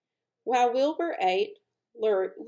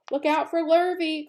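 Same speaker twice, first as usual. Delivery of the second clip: cows calling out; 8 semitones higher, 5 dB louder than usual. Acted. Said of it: "called the cows".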